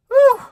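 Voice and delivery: high, loud mock-relief whoop of breath; acted exhausted whew. Rider after a hard trail ride, loud exhausted whew. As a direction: blow out a big, high-pitched whew.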